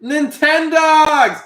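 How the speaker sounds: high, loud, drawn-out cry; excited recognition. Real, not acted. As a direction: shout the name in glee. Excited recognition of old game.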